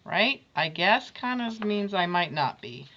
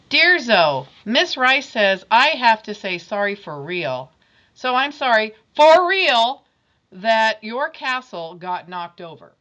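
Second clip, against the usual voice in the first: put-on loud, grudging kid apology; reluctant boy; 3 semitones higher, 9 dB louder than usual. Jack forced by Miss Rice to apologise, loud and grudging. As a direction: say sorry like you're made to.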